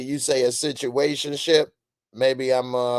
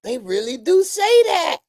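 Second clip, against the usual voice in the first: amazed; 6 dB louder than usual. Retold or imitated. Amazed retelling, shouted.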